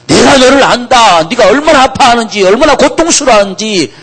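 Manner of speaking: high, loud, pleading proclamation; impassioned preaching. Acted. Preacher voices the Lord comforting suffering believers, high and loud. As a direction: pour out the Lord's compassion at full voice.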